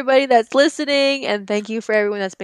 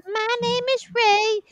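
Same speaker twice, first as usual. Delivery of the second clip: creepy doll voice; 11 semitones higher than usual. Acted. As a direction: voice a demonic horror doll. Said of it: "That was the creepiest sounding voice".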